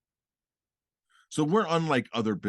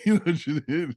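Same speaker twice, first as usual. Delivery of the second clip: laughing tease; 7 semitones higher, 6 dB louder than usual. Real, not acted. Teasing partner's claim.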